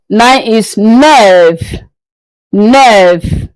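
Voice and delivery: slowly, nine said as nerve; French neuf. Shown as a demonstration. Teacher drilling neuf to the class. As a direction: model neuf, spelt nerve, clearly. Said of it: "Again, nerve".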